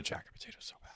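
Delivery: fast hushed whisper. Real, not acted. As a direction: whisper the craving quickly. Craving aside.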